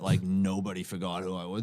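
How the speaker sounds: usual speaking voice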